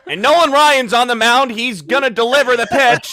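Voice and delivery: put-on old radio announcer. Shown as a demonstration. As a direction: call the pitch like an old-time radio man. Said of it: "old-timey radio announcer guy".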